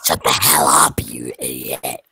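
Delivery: hammed-up shouting; mock angry shout. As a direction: bark the insult in fake anger. In-game insult shouted at friend.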